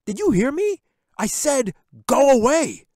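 all-out furious scream. A narrator's reading. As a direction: scream her out of the room. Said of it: "Jack yelled even louder".

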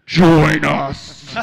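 loud, low demonic summons; deadite call. Retold or imitated. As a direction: boom it out like a possessed deadite. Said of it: "calling you and saying".

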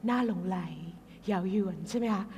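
low-key, alluring commercial delivery; enticing voiceover, kept gentle; seductive ad voice. Acted. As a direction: sell it like a tempting chocolate advert. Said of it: "เสียงมันจะแบบ".

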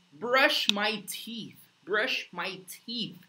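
teacher modelling at a crawl; slow pronunciation demo. Shown as a demonstration. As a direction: brush my teeth drawn out slowly, tongue on the final th. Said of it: "Linguinha no final ali".

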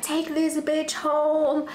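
slow, pointed retold order; retold command. Ordering her boyfriend to take the girl home, slowly and pointedly. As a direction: drag out each word as a command. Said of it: "And I'm like".

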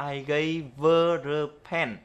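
slow, "gave her a" run together as "gave-va"; teacher models linked "I gave her a pen" slowly; linked pronunciation demo. Shown as a demonstration. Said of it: "cách đọc hoàn toàn đã được viết đầy đủ là".

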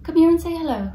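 high sing-song coaxing; teaching a parrot. Real, not acted. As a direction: coax the bird to speak, high and bright. Coaxing pet bird to talk.

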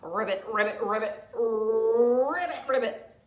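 frog croak. Acted.

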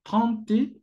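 trente dicho despacio y claro, la e final casi muda. Shown as a demonstration. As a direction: pronuncia trente con nasal francesa. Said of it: "como decimos treinta y uno". (pronunciación francesa clara)

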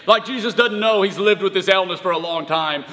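ordinary delivery

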